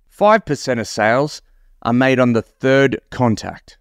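ordinary talk

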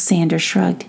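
ordinary delivery

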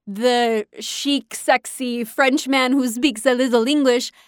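lightly put-on French accent on English, slow at the start; a mild, badly faked French accent. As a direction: play a chic Frenchman with a hint of French accent. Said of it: "sorry for my really bad French being, speaking English accent".

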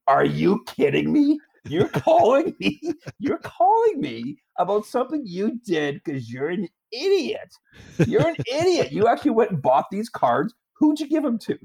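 high, mocking police officer scolding a caller; incredulous cop. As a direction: play a cop who can't believe the idiot calling.

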